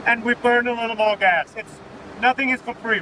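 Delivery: usual speaking voice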